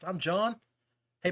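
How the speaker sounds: usual speaking voice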